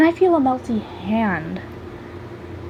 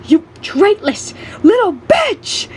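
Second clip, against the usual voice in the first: high, loud, drawn-out furious insult; trembling rage; 10 semitones higher, 5 dB louder, slower than usual. A narrator's reading. Punched man gets up to insult the narrator, raging. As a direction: spit each word out slowly, enraged. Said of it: "He gets up trembling in rage".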